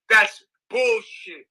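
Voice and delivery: drawn-out angry scoff; indignant, dragged-out scoff. Real, not acted. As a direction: spit out the insult slowly and with contempt. Angry rant at stalkers.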